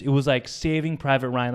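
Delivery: ordinary talk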